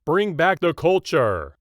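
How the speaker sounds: high, loud and slow angry fan yell; hammed-up demanding shout; mock scream. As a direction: bellow it like a fed-up fan. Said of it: "You scream".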